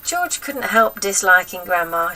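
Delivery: plain reading voice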